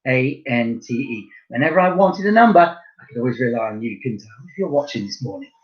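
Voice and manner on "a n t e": slow spelling out. Shown as a demonstration. Teacher spelling soixante slowly for pupils.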